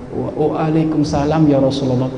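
faltering, halting reply from a sick man; broken weak voice. A narrator's reading. Sauban answers the Prophet from his sickbed, faltering. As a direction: stammer it out weakly, a touch of sickness. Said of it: "menjawab sambil terputus-putus suaranya".

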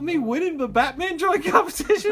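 put-on eager kid. Kid explaining the call.

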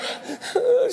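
sharp high gasp before crying; child's gasp. Retold or imitated. Daughter gasping before she starts to cry, high gasp. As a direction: gasp like a kid about to sob.

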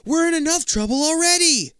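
high, loud anxious plea; urgent call. Acted.

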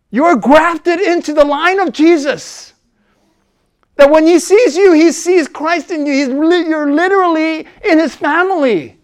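loud, high preacher's build; rousing pulpit voice; preaching at full force. Acted. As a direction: lift the room with it.

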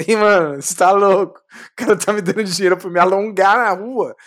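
loud, laughing disbelief. Real, not acted. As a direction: burst out laughing as you tell it. Laughing, retelling his amazement. (rindo alto, incrédulo)

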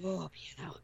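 breathy deflated whisper. Retold or imitated. Mocking her letdown about the piano, whispered. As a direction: whisper it, let down. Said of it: "She was like".

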